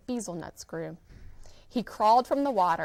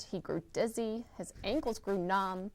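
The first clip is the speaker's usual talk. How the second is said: usual speaking voice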